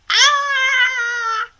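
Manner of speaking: high, loud cry of disgust. A narrator's reading. Hiccup cries out at the vomit on the pillow. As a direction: recoil with a yelp.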